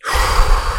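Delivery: imitated forceful sigh; big calming exhale. Imitating the stranger calming down, loud exhale. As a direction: blow out a loud, deep breath. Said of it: "And the guy's like".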